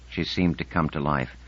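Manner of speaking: ordinary delivery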